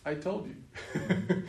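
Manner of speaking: I told you drawn out very slowly with a laugh; slow, amused. Retold or imitated. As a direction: let it land, slow and wry. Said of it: "He says".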